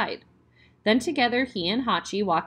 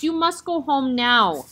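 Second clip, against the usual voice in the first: slow, louder stern order; 6 semitones higher, 5 dB louder, slower than usual. Acted. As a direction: send the puppy home firmly, slowly. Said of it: "spoke in a stern voice".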